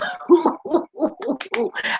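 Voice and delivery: long, high, loud cackle; whooping laugh. Real, not acted. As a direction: let out a drawn-out whooping laugh. Laughs after praising the copiers.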